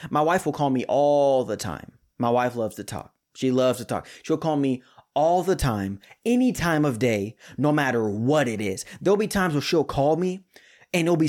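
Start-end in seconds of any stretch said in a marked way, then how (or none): none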